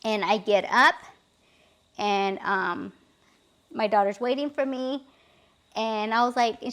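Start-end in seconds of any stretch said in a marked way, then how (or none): none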